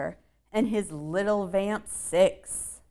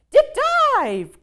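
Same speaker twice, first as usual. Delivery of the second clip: bat mom's cry; 10 dB louder than usual. Acted. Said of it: "cried the mom".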